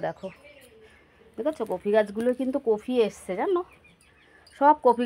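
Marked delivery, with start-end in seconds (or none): none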